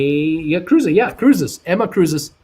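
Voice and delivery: the name clicks, say it with triumph; excited eureka. Real, not acted. Host finally remembering the channel name, excited.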